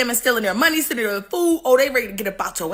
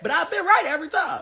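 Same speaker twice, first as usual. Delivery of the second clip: usual speaking voice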